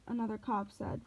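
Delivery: ordinary delivery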